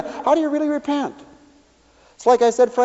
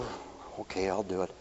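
grudging; 13 semitones lower, 18 dB quieter than usual. Retold or imitated.

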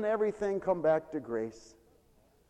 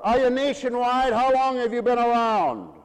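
harsh clerk; 8 semitones higher, 8 dB louder than usual. Retold or imitated. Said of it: "She was very harsh".

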